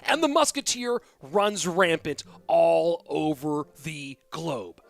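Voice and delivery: loud, slow epic trailer narrator; movie trailer voice. Shown as a demonstration. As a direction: boom it like a blockbuster trailer. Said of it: "do the in a world?".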